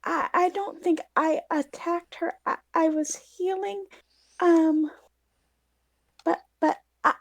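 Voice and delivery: timid high voice caught off guard; nervous stammer. Acted. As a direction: stumble over the words, scared of being blamed. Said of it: "so she swallows and is like".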